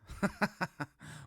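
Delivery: high, bursting cackle; string of high, quick chuckles; amused laugh. Real, not acted. Laughs at an odd chat comment.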